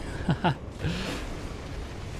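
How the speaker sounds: quiet chuckle lifting in pitch; soft breathy laugh. Real, not acted. Player spotting enemies above him, soft breathy laugh. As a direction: laugh softly under your breath.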